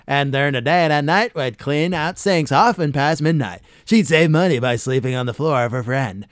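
loud, booming countdown-host read; Casey Kasem talk-up. Retold or imitated. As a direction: ham up a radio host's hype patter. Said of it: "he'd do the whole talk up like you just heard".

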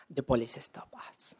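whispered, hushed and tense; a storyteller's hushed reveal; dramatic whisper. Real, not acted. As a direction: drop to a whisper for the twist.

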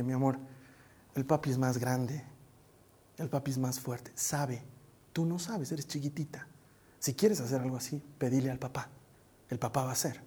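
low, hushed, tender voice; gentle fatherly reassurance. Retold or imitated. Father calming daughter after the costume struggle, tender reassurance. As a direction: explain softly and patiently to a small child.

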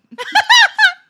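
big high burst of laughter; loud laugh. Real, not acted. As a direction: crack up hard.

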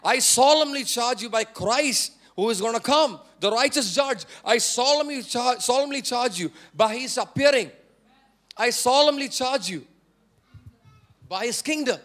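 loud, forceful, emphatic command; unfiltered solemn charge. Acted. Paul charging Timothy straight to the point, loud and solemn. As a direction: proclaim it with full force, every phrase driven home. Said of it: "no filters. Paul is just straight away getting into the point".

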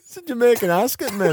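put-on high-pitched Jamaican accent; hammed-up Jamaican accent. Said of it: "kick-ass Jamaican".

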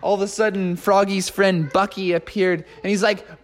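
ordinary delivery, nothing marked

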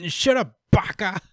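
high mock anime snap; hammed-up tsundere insult; tsundere retort. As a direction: fling it like an embarrassed anime girl. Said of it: "tsundere mode".

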